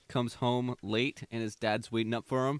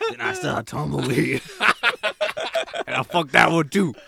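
cowboy twang; 5 dB louder than usual. Retold or imitated. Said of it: "talking in a Western accent".

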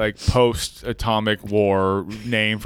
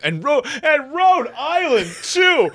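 mock pleading. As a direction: squeak it out like a desperate do-gooder. Mock pleading for Rhode Island.

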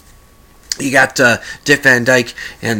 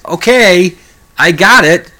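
exasperated thought; 6 semitones higher, 6 dB louder than usual. Retold or imitated. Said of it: "I was thinking".

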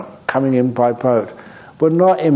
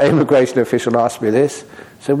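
usual speaking voice

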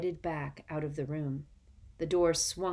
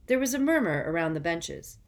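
usual speaking voice

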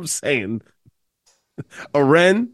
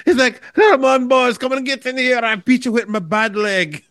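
mock crazy uncle; 7 semitones higher than usual. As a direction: play the wild uncle calling the kids in, half-laughing. Mimicking the crazy uncle's lines.

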